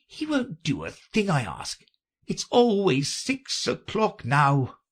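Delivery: high, mildly mournful lament. A narrator's reading. The Hatter laments his quarrel with Time, mournful. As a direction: complain dolefully, drawing out the last words. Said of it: "in a mournful tone".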